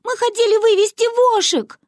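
put-on very high, whining little girl; wheedling high-pitched plea; Манюня. A narrator's reading. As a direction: whine the excuse in a squeaky child voice. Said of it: "стала конючить Манюня".

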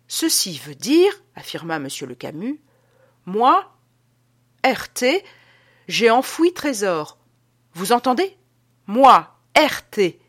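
déclamer haut et fort, en détachant les mots; révélation solennelle. Acted. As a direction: proclamation théâtrale et lente. Adolphe révèle le sens du document. (annoncer un secret capital, avec emphase)

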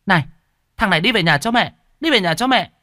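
high, loud angry mum voice; scolding mother. Acted. Mother smacks her son and orders him home, angry scolding.

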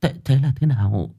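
breathless frightened whisper; trembling voice. Acted. Shocked mother whispers to Bích in fear. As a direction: gasp it out, barely able to speak. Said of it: "Giọng bà run rẩy".

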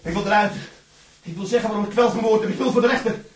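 loud, high insistent yells; desperate shouting. Acted. Prisoner shouting at the guard who tells him to calm down, desperate shouting. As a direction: keep hammering the demand.

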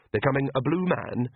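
usual speaking voice

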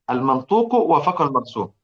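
usual speaking voice

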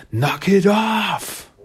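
high, drawn-out mock yell; acted scream at everybody; imitated scream. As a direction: yell it long and high to silence a whole room. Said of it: "you really screaming".

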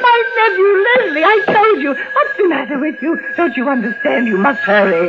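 high, fast, frightened demands; panicked urgency. Acted. Terrified aunt urges the operator to reach her nephew. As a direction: hurry the operator along.

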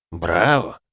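ordinary delivery, nothing marked

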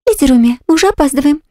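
high, bright chirpy maid; chirping. Acted. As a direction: chirp it, a sweet cartoon princess. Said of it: "прощебетала моя служанка". (прощебетала)

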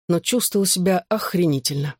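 ordinary delivery

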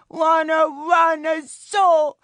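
put-on very high, loud bawling child; screaming toddler tantrum. A narrator's reading. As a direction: wail it like a furious small boy.

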